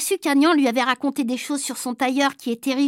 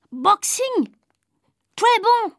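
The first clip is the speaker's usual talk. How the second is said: put-on higher, eager foreign boy cry; excited boy; 7 semitones higher, much slower than usual. New boy excitedly invites Eudes to box. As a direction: burst out with glee.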